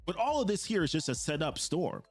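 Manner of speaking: normal talking voice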